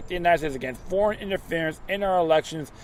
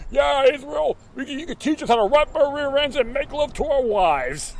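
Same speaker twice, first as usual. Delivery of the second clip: 1 mocking official; 9 semitones higher than usual. Mock voice of the officials.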